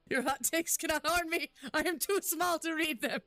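playful taunt. Pretend. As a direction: tease them from safety.

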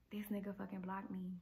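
softly stunned muttered realisation about being blocked; stunned undertone. Real, not acted. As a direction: mutter it in disbelief. Muttering in disbelief.

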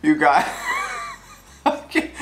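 high breathless giggling; real helpless laughter; laughing fit. Real, not acted. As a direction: crack up, can't get words out. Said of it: "I can't stop laughing".

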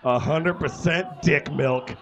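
reading through giggles; can barely get the words out for laughing; laughing while reading. Real, not acted. Reading erotic fan fiction, laughing.

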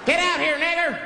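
high-pitched hostile yell; mob shout. Acted. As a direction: shout a threat from outside a house. Mob shouts at caller's house.

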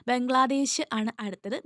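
normal talking voice

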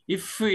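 푸 let out with a short puff of air; wrong pronunciation demo. Shown as a demonstration. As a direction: release the final ㅍ as a separate 푸. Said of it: "하지 않죠".

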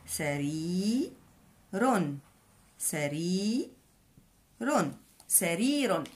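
سرير said slowly, split into its syllables; slow classroom demonstration. Shown as a demonstration. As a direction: sound out سرير piece by piece. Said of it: "كلمه سرير تكتب بهذا الشكل".

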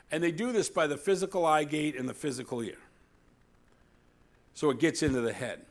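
loud, high preaching build; full-force preaching. Real, not acted. Pastor preaching hard to his church about demons. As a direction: preach it out to the back row.

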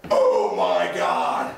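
very low, slow shout; deep slow medic. Acted. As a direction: bellow it out slowly. Medic arrives.

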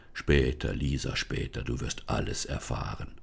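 put-on low, relieved forest spirit greeting the girl; deep forest spirit, relieved to see Lisa. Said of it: "die Stimme des Waldgeistes".

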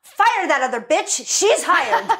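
high, punchy mock command from a producer; Avi Arad. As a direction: bark it out like a blunt boss, hammed up. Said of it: "He goes".

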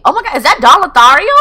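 high, loud, fast exclamation; starstruck surprise. Real, not acted. Player spotting Don Lothario, surprised. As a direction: blurt it in excited disbelief. Spots a famous Sim.

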